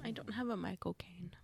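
soft unvoiced whisper. Real, not acted. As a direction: whisper it back. Whispered reply.

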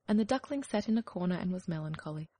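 ordinary delivery

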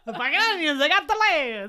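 put-on loud, high, laughing Jersey accent; mock Jersey accent. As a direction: ham up a brash Jersey accent. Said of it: "turned into a Jersey".

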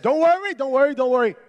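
high, loud, repeated reassurance; urgent reassurance. Retold or imitated. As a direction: cover your panic by calming everyone loudly. Hurriedly reassuring the crowd, retold.

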